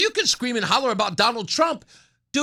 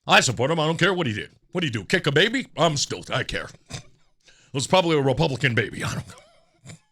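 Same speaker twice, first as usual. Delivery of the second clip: very low, dopey supporter voice; mock Plattner supporter; 9 semitones lower, faster than usual. Mocking a voter who backs him no matter what, low and dopey. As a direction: drone it low like a clueless partisan. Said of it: "Just shut up".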